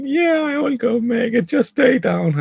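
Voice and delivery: high, loud, urgent reply; eager wife. Retold or imitated. Wife hurrying off to fetch juice, high and urgent. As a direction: voice the wife rushing to help. Wife agrees, tells him stay down.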